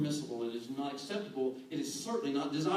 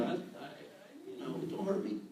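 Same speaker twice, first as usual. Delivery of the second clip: mock timid plea; 7 semitones higher, 4 dB quieter than usual. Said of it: "Sydney's leg over here saying".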